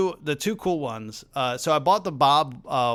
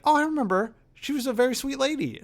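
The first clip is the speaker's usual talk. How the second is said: a much higher, gentle, quick imitation of an elderly man; kindly old man; 12 semitones higher, faster than usual. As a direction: recall her fondly as a sweet old gentleman. Old man voiced in story.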